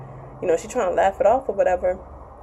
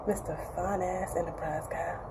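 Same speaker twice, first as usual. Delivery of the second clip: swooning aside; 2 semitones lower, 12 dB quieter than usual. Acted. Vlogger swooning over the show's handsome guy, softly. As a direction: breathe the name like a crush.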